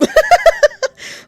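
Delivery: big squealing laugh; loud high laugh. Real, not acted. Laughs at own outburst.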